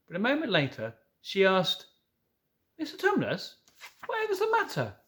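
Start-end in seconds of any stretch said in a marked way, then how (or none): 2.8-4.9 put-on much higher anxious girl voice; alarmed Lucy. A narrator's reading. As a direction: reach for him in sudden worry.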